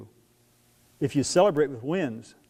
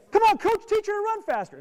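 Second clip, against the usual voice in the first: mock parent yell. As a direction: shout across the track at the coach. Parent yelling at coach.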